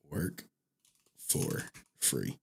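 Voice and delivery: low hushed murmur fading out; soft trailing read. Real, not acted. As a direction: let it slip away under your breath. Reading softly, trailing off.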